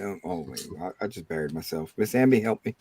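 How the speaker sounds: normal talking voice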